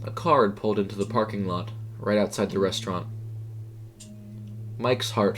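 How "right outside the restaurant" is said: plain reading voice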